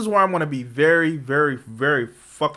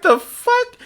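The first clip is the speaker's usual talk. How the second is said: mock shocked; 6 dB louder than usual. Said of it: "He was like".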